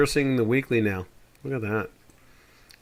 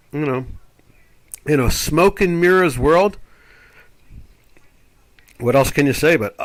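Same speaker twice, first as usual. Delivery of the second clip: ordinary delivery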